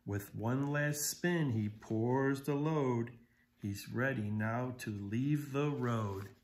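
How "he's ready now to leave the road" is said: ordinary delivery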